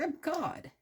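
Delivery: high, strange voice of God putting Noah in his place; booming God voice. Retold or imitated. God cuts off Noah's question in an otherworldly voice. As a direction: play God, impatient with doubts.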